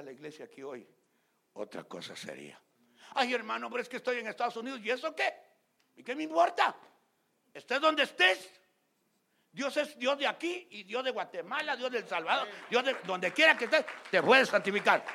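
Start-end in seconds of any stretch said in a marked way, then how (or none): 3.1-15.0 loud, high rebuking preaching. Acted. Acted excuse and pastor's rebuke. (predicación de reproche a todo pulmón)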